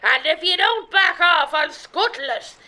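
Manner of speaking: put-on pirate queen accent with a long arr; hammed-up pirate accent over a megaphone. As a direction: threaten like a storybook pirate. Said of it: "You have to do the accent".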